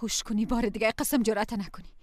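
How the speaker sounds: quiet menacing threat; low warning. Acted. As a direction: warn him low, never again. Wife warns husband.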